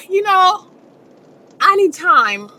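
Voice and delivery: high, gasping mock sobs between words; fake crying. Shown as a demonstration. Wife asking yelling husband for time, fake crying. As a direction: catch your breath as if sobbing, put-on.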